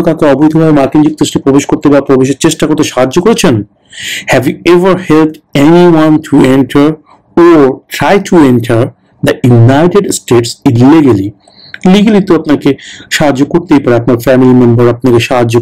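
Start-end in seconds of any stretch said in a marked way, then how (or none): 4.3-11.3 very slow, deliberate question reading; slow, clear interview-question read; slow test question read. Shown as a demonstration. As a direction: read it out word by word for learners. Reads the interview question aloud.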